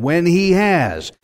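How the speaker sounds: slow, deliberate weight on HAS; stress on HAS. Shown as a demonstration. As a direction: stretch out HAS with certainty. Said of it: "Gideon did not say, If".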